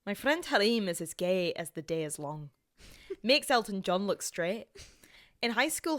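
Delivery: story read in an attempted Scottish accent. Reading a friend's story on request, Scottish accent. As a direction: read it out as a Scot. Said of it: "Can you do Scottish?".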